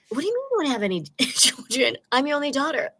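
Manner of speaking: high, incredulous protest with a laugh; stunned disbelief. Retold or imitated. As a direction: refuse to believe what mom just said. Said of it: "I was like".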